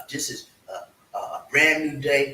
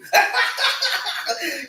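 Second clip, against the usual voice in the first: big high laugh breaking out; hearty laugh. Real, not acted. Laughing at own promise.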